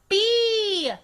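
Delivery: loud, high yell; shouted Bee. A narrator's reading. Girl correcting teacher's name for her, loud high yell. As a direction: holler it so she won't forget. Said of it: "I said".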